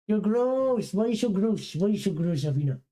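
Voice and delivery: high teasing scold; dog owner teasing after a lick; mock disgust. Real, not acted.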